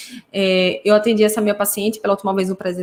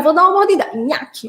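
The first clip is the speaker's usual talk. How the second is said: mordida de brincadeira; 5 semitones higher than usual. Shown as a demonstration. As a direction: announce the bite playfully to a child, pitched up. Demonstra brincadeira de morder. (aviso brincalhão de mordida, em tom agudo)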